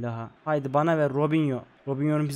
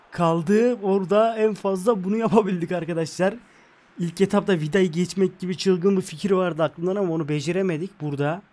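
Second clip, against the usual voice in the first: ordinary talk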